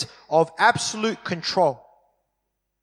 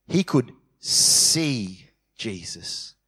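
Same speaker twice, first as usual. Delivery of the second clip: "see" drawn out, low and slow; stress on see; 7 semitones lower, much slower than usual. Shown as a demonstration. Preacher stresses "see" with slow low voice. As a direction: lean on "see" slowly, deep. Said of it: "I'll just emphasis on the word".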